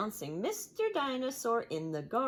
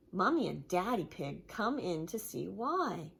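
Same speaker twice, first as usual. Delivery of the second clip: plain reading voice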